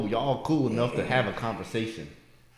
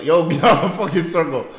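loud mock yell from a passing car; yelled callout; 4 semitones higher, 6 dB louder than usual. Driver yelling at workers in circle, mock yell. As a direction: call the man out of the circle. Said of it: "someone just yell".

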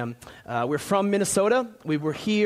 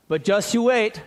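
Minnesota warning; 5 semitones higher, 4 dB louder than usual. Retold or imitated. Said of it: "here's what we say in Minnesota".

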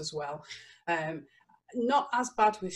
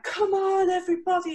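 much higher and louder, an overjoyed dog calling everyone over; happy dog voice; 12 semitones higher, 7 dB louder than usual. Retold or imitated. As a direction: voice the earless German Shepherd bounding up, thrilled. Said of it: "He's like".

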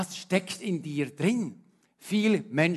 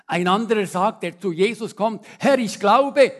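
loud fervent sermon delivery; impassioned preaching; 6 semitones higher, 12 dB louder than usual. Acted. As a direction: preach it out to the congregation. Preaching at full force. (lauter, eindringlicher Predigtton)

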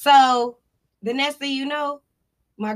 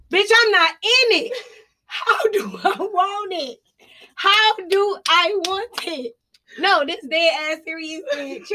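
indignant; 6 semitones higher than usual. Retold or imitated. Retold indignant shouting.

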